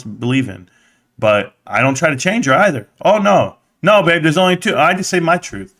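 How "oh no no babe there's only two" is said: firm correction. Retold or imitated. Telling daughter there are two genders.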